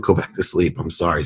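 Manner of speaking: hushed and gentle; soft apology. Retold or imitated. Father apologizing to his sleepy daughter, softly. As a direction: softly send her back to sleep.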